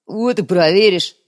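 slow, loud goblin retort; goblin snap. Acted. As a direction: snap it back gruffly. Chief goblin snaps back.

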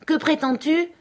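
high, startled demand; alarmed question. Acted. Scott startled as Matt fetches the rifle, high alarmed. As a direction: blurt it out, alarmed.

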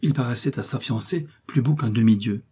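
ordinary delivery, nothing marked

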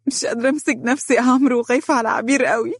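high, fast, tearful pleading; words breaking through sobs; crying hard. Acted. Azza crying to Amr, afraid for her sister. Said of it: "بكت عزة بقوة أكبر".

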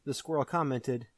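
ordinary delivery

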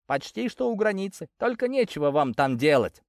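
high-pitched, scornful know-it-all; jeering young local. A narrator's reading. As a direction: jeer at a clueless stranger in a high voice. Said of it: "передразнил меня тот, что помоложе".

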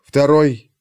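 plain reading voice

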